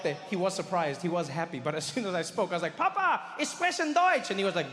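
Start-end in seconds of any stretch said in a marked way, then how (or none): 2.8-4.3 very high, slow proud reveal; sing-out greeting to Papa, drawn out; hammed-up triumphant announcement; excited announcement.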